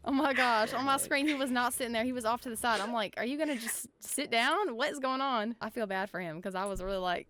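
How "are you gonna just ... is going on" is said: mildly exasperated, raised-pitch retelling. Retold or imitated. Retelling her exasperation at a guest.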